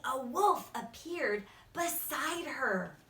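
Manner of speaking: plain reading voice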